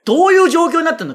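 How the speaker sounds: high, loud incredulous outburst; comic scolding. Pretend. Host tearing into a listener's car mishap, comic scolding. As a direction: berate the listener in mock outrage. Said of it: "皆さんの失敗を即興でのしる".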